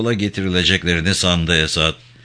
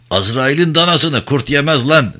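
raised, higher-pitched menace; rough threat; 6 semitones higher, 4 dB louder than usual. A narrator's reading. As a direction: throw it at him as an angry taunt. Freckled policeman threatens the prisoner.

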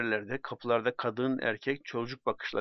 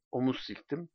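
ordinary delivery, nothing marked

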